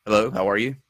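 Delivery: normal talking voice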